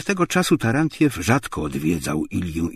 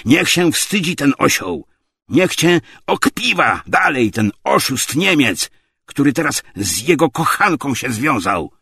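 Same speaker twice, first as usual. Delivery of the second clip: loud, raised, scornful bawling; bawled abuse; 8 semitones higher, 7 dB louder than usual. A narrator's reading. Tarantiew shames Obłomow before everyone, bawling. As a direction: shout the insults for passers-by. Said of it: "gardłował Michej Andrzejewicz".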